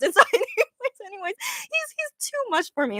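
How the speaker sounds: high-pitched, laughing through the words; giggling. Real, not acted. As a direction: squeak it out, overcome with giggles. Overcome with giggles over performer.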